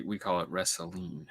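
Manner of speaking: a light Southern drawl on "wrestling"; Southern accent. Shown as a demonstration. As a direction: say "we call it wrestling" as a Texan. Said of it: "next time you're doing your Southern accent".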